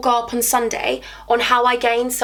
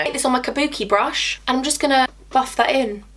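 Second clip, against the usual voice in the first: ordinary talk, nothing marked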